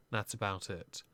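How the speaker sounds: ordinary talk, nothing marked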